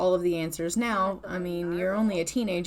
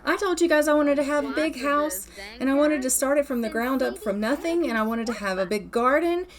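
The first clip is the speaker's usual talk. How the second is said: put-on higher-pitched, aggrieved teen complaint; indignant protest; 5 semitones higher than usual. Daughter complains brother gets all the excitement, indignant. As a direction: act out an indignant, overlooked sister.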